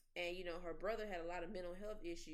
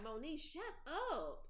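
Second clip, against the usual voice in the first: mock scolding; 7 semitones higher than usual. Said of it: "I'm like".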